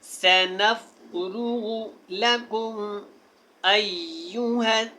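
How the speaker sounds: slow chanted verse with ringing सक़लान; model recitation. Shown as a demonstration. Teacher recites the verse so students copy it. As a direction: recite it beautifully. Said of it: "जैसे मैंने पढ़ा ना".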